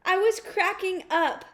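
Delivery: usual speaking voice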